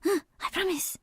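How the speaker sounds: lightly voiced small boy, high and soft; a little brother promising, hushed; young boy voice. Small brother answers his sister in a hushed high voice.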